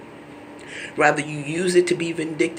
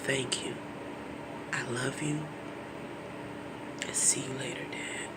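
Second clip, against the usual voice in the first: much softer, barely voiced; whispered last goodbye to dad; 4 semitones lower, 16 dB quieter than usual. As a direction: breathe the words to a dying father. Last words to dying father.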